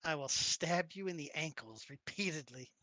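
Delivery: low, measured menace; deadpan little threat; mock threat. Player character threatens companion.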